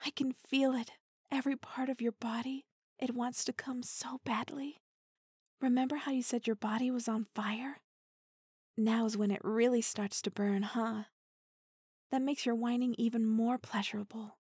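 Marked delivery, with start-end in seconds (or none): 1.3-4.8 intimate teasing whisper; close, breathy-free hushed tease. Acted. 12.1-14.4 intimate teasing whisper; close, breathy-free hushed tease. Acted.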